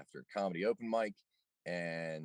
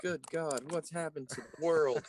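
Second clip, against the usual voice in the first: mock alarm; 7 semitones higher than usual. Mock alarm at audio glitch.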